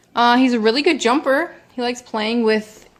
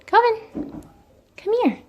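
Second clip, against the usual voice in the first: high sing-song come here; calling the cat; 13 semitones higher than usual. Real, not acted. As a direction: coax the cat over, high.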